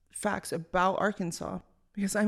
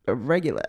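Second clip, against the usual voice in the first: real laugh; 5 semitones higher, 7 dB louder than usual. Real, not acted. Laughing at her own joke.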